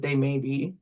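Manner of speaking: ordinary talk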